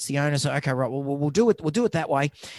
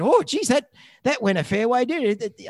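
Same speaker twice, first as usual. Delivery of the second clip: higher, louder pet owner in mild shock; a touch of the stunned owner, pitched up; surprised owner; 7 semitones higher, 4 dB louder than usual. Retold or imitated. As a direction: play the startled client. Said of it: "the owner said".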